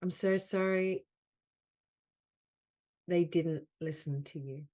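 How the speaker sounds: measured delivery, leaning on "so sorry"; guided healing affirmation. Acted. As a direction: offer each apology as a healing phrase. Leads healing phrases with long pauses.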